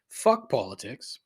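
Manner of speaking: usual speaking voice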